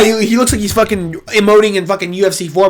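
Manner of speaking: normal talking voice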